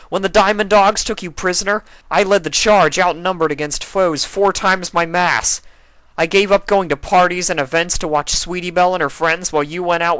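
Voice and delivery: loud, hurt rant; angry tirade. Acted. Spike ranting at Rarity, loud and hurt. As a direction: pour out years of grievance.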